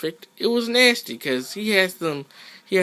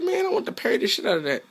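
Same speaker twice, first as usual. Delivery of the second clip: very high, fast squeaky voice; quoting himself; faster than usual. Retold or imitated. Gamer voicing his own thoughts mid-match, fast squeaky cartoon voice. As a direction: rattle it off in a squeaky cartoon pitch. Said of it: "I was like".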